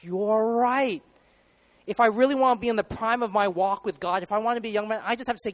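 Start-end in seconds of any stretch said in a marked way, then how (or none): 0.0-1.0 excited realization. Acted. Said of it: "you just have to stop and say".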